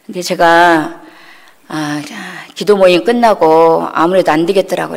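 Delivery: ordinary delivery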